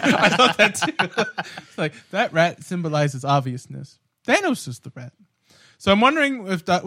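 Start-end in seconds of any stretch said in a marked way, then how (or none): 0.0-1.4 slow, laughing repeat. Real, not acted. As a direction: drag it out through laughter. Repeats line laughing slowly.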